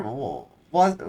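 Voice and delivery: ordinary delivery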